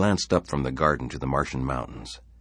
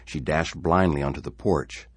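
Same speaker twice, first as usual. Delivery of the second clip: ordinary delivery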